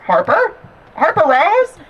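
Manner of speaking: mom calling loudly. Retold or imitated. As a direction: call her name across the room. Mom calling over the phone.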